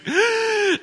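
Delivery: high, drawn-in gasp; imitated pre-cry gasp; mock sobbing gasp. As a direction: gasp as if about to burst into tears. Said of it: "She, like, exploded".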